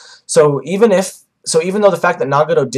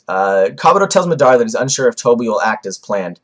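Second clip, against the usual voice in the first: normal talking voice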